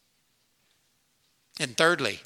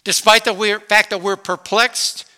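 rising preaching; 7 semitones higher, 9 dB louder than usual. Real, not acted. Preaching build-up.

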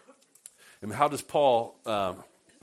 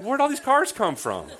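startled laughing surprise; 12 semitones higher, 7 dB louder than usual. Retold or imitated. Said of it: "I was like".

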